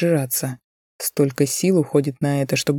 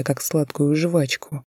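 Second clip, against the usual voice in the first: ordinary delivery, nothing marked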